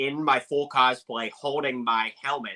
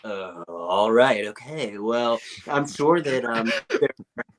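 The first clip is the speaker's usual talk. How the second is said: a fan imitating Jeff Goldblum at his jazz show; Jeff Goldblum impression. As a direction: stretch the pauses like Jeff Goldblum. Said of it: "He goes".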